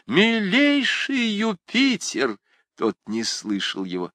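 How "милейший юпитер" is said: calling out. A narrator's reading. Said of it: "сказал он".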